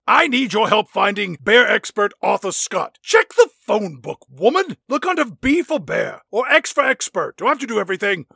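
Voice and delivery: high, pushy mock ranger voice; hammed-up demanding boss; bossy Ranger Kelly. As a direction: snap orders down the phone. Ranger Kelly bossing phone.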